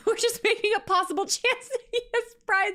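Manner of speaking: high, loud words through laughter; laughing. Real, not acted. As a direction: squeal it out mid-laugh. Laughing at their guesses.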